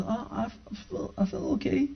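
very soft, high, halting reply; hesitant wife. Retold or imitated. Wife answering husband's check on how she feels, hesitant and soft. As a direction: imitate a wife answering in a small, faltering voice.

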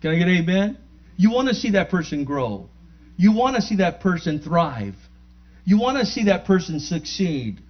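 loud, rising preacher's call; rally the church, each line building; full-voiced sermon cadence; preaching.

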